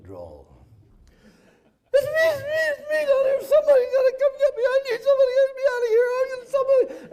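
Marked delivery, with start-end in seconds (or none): 1.9-7.1 much higher, louder panicked babble, fast; voice up three octaves. Retold or imitated. Downed pilot on the radio begging to be picked up, panicked high babble. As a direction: squeal it out in a rushed, breaking panic. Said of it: "my voice was up about three octaves".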